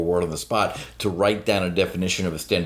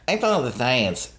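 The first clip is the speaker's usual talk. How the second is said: higher mocking chant; lisped, raised-pitch slogan; mock mantra; 4 semitones higher than usual. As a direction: parrot it like an empty mantra. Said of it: "is what we call a mantra".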